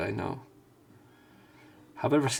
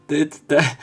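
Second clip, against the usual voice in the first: loud excited; 5 semitones higher, 10 dB louder than usual. Real, not acted. Still reacting, laughing.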